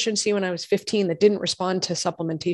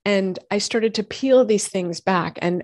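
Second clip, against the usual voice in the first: usual speaking voice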